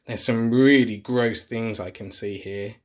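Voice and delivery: usual speaking voice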